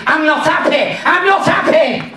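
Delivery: high-pitched mock yell, rising on the repeat; angry shout. Dwarf shouting at the driver who hit him, mock outrage. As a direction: act out a furious little dwarf. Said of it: "He shouted".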